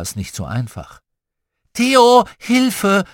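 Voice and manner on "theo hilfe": high, loud cry for help; little sister demanding help at once; demanding call. A narrator's reading. Little sister calls her brother while climbing, demanding. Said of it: "verlangte Lotti".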